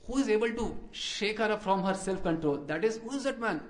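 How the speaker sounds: ordinary talk, nothing marked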